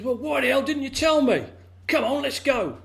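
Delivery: excited outburst. Acted. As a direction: burst out, dying to jump in. Frog excited demands, rushes off.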